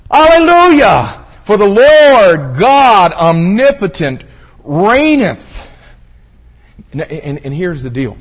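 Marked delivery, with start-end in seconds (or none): 0.1-5.7 slow, high, booming proclamation; huge heavenly voice at full power; massive crying voice. Acted. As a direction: shout it out grandly, stretching each word. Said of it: "this massive voice crying".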